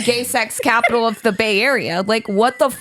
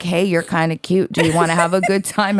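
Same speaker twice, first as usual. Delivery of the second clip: a lower, sleazy pickup line; lower sleazy come-on; 6 semitones lower than usual. Retold or imitated. Stranger leaning into the car window, lower mock come-on. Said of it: "This dude leans in my car and is like".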